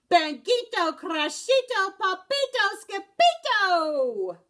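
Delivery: high, loud drawn-out calls; excited shouts. Acted. Skippy John shouting in his room, loud and excited. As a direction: call out each name wildly. Kitten shouting during ruckus.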